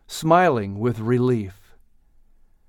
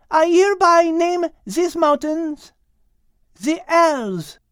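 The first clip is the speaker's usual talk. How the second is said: proclaiming Liz. Acted. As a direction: proclaim it to the crowd. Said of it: "Liz exclaimed".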